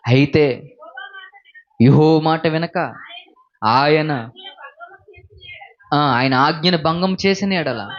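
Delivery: slow, measured reading of the verse; scripture reading. Acted. Preacher reading scripture to the congregation, slow and measured. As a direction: read the verse with weight and care.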